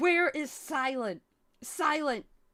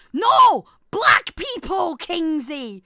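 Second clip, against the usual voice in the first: exasperated shout; 2 semitones higher, 11 dB louder, slower than usual. Real, not acted. Correcting Kingsey about the word, exasperated shout.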